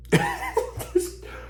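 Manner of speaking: high squeaky laugh that tips toward crying; despairing laugh. Real, not acted. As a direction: laugh so you don't cry. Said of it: "I'm laughing, but I'm actually crying".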